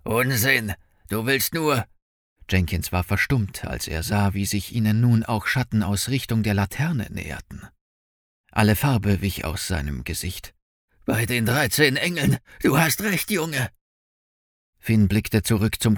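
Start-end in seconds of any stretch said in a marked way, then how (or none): 0.0-1.9 curt dismissal, pitched up; dismissive bounty hunter. A narrator's reading. Bounty hunter rejects Finn's warning, impatient and dismissive. 11.1-13.7 rising, higher-pitched horrified realization; alarmed bounty hunter. A narrator's reading. Bounty hunter sees shadows closing in, alarmed.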